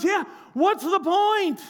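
high, stretched-out holler back; imitated yell. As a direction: mimic her calling back from the water. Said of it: "she yells to the boat".

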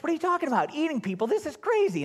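a hint of shocked disbelief; confused listeners. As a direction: play the baffled crowd. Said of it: "that gets everybody all confused".

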